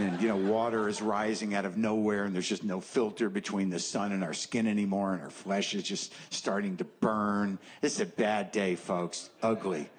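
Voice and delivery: normal talking voice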